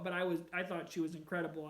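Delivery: usual speaking voice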